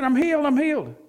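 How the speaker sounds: high, loud, repeated cry of joy; old lady calling out her healing; joyful shout. Retold or imitated. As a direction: shout it down the hall, overjoyed. Woman shouting down hall.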